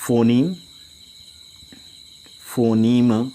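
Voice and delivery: slow, each phrase set apart by long pauses; slow dictation. Shown as a demonstration. Teacher dictating a rule while writing in red pen, slowly. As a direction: dictate it bit by bit for copying. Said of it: "ये red pen से लिख रहा हूँ".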